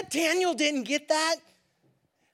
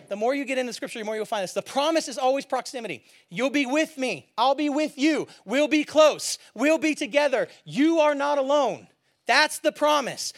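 usual speaking voice

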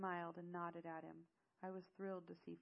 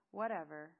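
loud dismissive eye-roll; 10 dB louder than usual. A narrator's reading. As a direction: brush it off, annoyed. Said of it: "rolled her eyes".

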